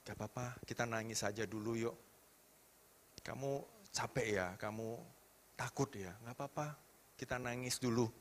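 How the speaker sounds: very soft, low soothing voice; gentle comforting. Retold or imitated. Father comforting his crying seven-year-old, soft and soothing. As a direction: hush it down and reassure tenderly.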